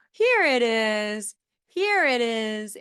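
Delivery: "Here it is" twice, very slow, with a lift on "Here"; slow repeated model. Shown as a demonstration. Therapist repeating a phrase to a child, slow and drawn out. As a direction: draw out each word as if pointing. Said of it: "you're just kind of pointing".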